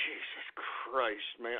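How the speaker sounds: low, soft, slow sigh of disgust; spent, muttered exasperation; weary exasperation. Real, not acted. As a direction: deflate into a tired groan. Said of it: "I'm still a little upset".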